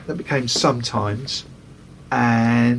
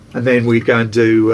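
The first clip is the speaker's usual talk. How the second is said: ordinary delivery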